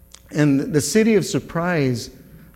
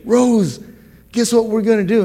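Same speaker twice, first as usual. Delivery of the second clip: higher and louder, bursting with news; excited announcement; 8 semitones higher, 4 dB louder than usual. Retold or imitated. Husband bursting in to tell his wife, excited and loud. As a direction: call out to her with thrilling news.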